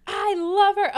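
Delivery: high, loud, slow mock drunken declaration; imitating drunk scene. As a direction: ham up the drunk guy causing a scene. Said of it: "he makes a fucking scene".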